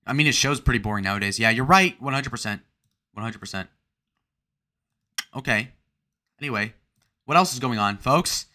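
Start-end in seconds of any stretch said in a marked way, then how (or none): none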